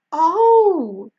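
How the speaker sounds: loud, high gasp of realisation; astonished, drawn-out exclamation. A narrator's reading. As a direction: let it out as it dawns. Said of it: "gasps May".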